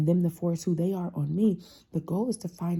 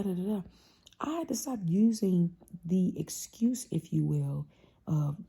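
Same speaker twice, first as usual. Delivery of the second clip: ordinary delivery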